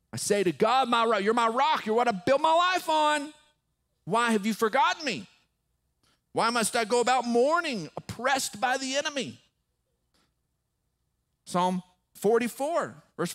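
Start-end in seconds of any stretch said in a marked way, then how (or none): 0.1-9.4 raised, high pleading voice; impassioned complaint. Acted. Preacher voicing the psalmist who feels forgotten by God, impassioned plea. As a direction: plead forcefully with God, pitched up.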